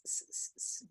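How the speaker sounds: whispered hissing s sounds. Shown as a demonstration. Teacher isolating the S sound for students. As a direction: hiss s, s, s softly. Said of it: "with the S sound".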